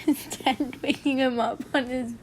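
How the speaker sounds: plain reading voice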